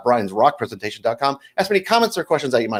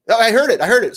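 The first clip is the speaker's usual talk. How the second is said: hasty bluff; 10 semitones higher, 4 dB louder than usual. Acted. Dad hastily bluffing to daughter.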